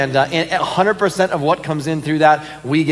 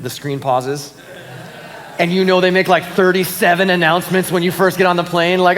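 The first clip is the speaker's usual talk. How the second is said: usual speaking voice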